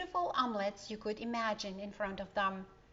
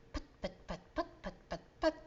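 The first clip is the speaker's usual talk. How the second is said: soft putt-putt of a motor; engine putt-putt; 5 dB quieter, faster than usual. A narrator's reading. Narrator imitating the boat motor, soft putts. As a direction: sound out a little boat engine.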